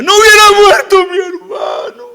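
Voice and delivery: high, loud tearful wail; crying out in grief. Acted. Preacher as weeping sister reproaching Jesus, loud wail. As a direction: pour out the pain in a high cry. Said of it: "y llorando".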